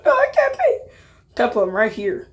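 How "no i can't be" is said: laughing disbelief. Acted.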